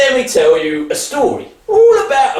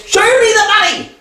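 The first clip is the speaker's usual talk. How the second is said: higher, fast, insistent demand; pushy demand; 9 semitones higher, much faster than usual. Acted. Son to father, insisting on the money, pushy and quick.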